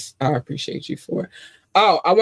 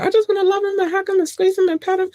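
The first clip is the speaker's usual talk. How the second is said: very high, fast, squeaky gushing; high breathless cartoon-girl gush; Animaniacs little girl; faster than usual. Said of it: "I sound like the little girl".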